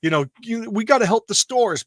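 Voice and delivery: put-on gushing, high and mocking; mocking fake fan. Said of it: "she's trying to be like".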